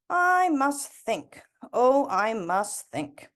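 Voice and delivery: slow, drawn-out musing; pondering old woman. Acted. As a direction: think it over aloud, slowly. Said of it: "Granny said to him".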